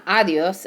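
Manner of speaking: ADIOS stressed on the first syllable, AH-dios; wrong-syllable stress. Shown as a demonstration. Teacher showing students a mistake, "adios" stressed on the A. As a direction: punch the "A" at the start of "adios". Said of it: "puts emphasis on the syllable".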